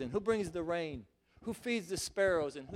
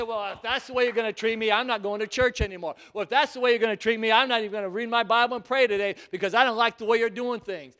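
frustrated complaint; 2 semitones higher, 8 dB louder than usual. Acted. Believer complaining to God.

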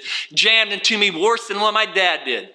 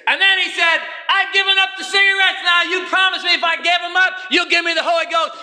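fervent preaching; 8 semitones higher than usual. Acted. Preacher retelling the smoker begging God. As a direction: cry out the smoker's prayer.

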